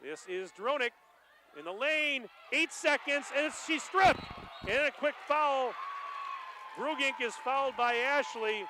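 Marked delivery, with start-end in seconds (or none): 1.6-8.6 excited call. Real, not acted. Final seconds, frantic play call.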